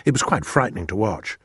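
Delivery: ordinary delivery, nothing marked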